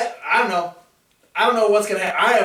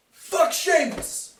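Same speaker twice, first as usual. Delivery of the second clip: mock yell; 12 semitones higher, much slower than usual. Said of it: "what Brock Lesnar should have yelled".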